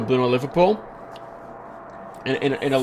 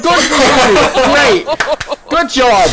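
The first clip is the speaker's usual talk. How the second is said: sarcastic praise; 8 semitones higher, 3 dB louder, much slower than usual. Retold or imitated. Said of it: "It's like".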